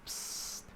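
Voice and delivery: hushed psst; psst whisper. Acted. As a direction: call them in close. Whisper call.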